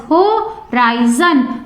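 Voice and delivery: Horizon slowly broken as Ho-ri-zon; split into syllables. Shown as a demonstration. Teacher showing students three syllables, Horizon split as Ho-ri-zon. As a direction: say Horizon in three separate beats. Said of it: "these words have three syllables".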